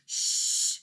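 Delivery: whispered hush; shushing. A narrator's reading. Cow warning the others, whispered shush. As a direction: hush them urgently.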